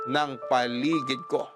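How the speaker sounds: ordinary talk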